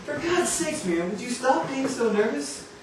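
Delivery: high, coaxing, amused exasperation; teasing exasperation. Acted. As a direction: tease her out of her nerves. Producer coaxing again.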